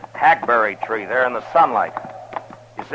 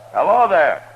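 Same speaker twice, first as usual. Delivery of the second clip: higher, louder hail across a distance; calling out; 7 semitones higher, 4 dB louder than usual. Acted. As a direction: call out to someone far off. Hailing a woman from horseback.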